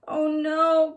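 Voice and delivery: put-on a lost girl realizing the dark woods; alarmed high exclamation; crying out in fear. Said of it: "cried Leola".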